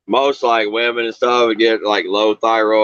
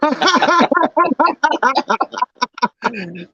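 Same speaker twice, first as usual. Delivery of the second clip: long high cackle. Real, not acted. Laughs at own joke.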